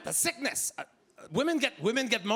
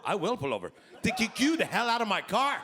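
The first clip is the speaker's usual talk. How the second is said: normal talking voice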